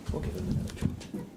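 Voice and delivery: ordinary talk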